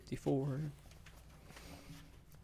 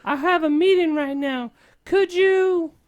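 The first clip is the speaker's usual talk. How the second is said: worried Leia; 5 dB louder than usual. Acted. Princess asks the hero about Luke, high worried voice. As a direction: lift the voice high and fret. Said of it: "Leia says with concerns".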